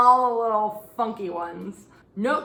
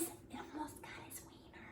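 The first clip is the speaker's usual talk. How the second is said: much softer, voiceless whisper; scandalized whisper; 6 semitones higher, 23 dB quieter than usual. Real, not acted. Telling viewers about the near miss, whispered. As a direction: whisper it like a naughty secret.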